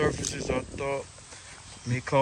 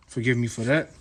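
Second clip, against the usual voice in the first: ordinary delivery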